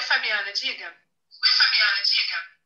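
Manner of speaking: usual speaking voice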